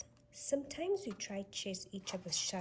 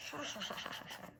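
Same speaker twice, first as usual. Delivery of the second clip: breathless giggle that fades out; soft ticklish giggle; tickled giggle; 4 semitones higher, 6 dB quieter, much faster than usual. Acted. Being tickled.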